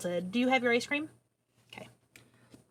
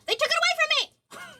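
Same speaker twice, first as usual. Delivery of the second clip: high-pitched, hammed-up whine of protest; mock wail. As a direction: complain like a wronged child, playfully. Mock complaint about silenced streams.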